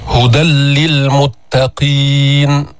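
هدى للمتقين recited slow, tanween merged into a doubled لام, no nasal; doubled lam. Shown as a demonstration. Teacher models the verse for students, slow recitation. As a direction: chant slowly, no نون and no ghunna. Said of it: "هل تسمع النون؟".

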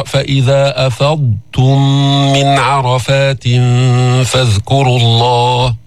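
slow measured recital; تلاوة هادئة; Quran recitation. Shown as a demonstration. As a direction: recite the verse deliberately. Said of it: "في قوله تعالى بسورة البقرة".

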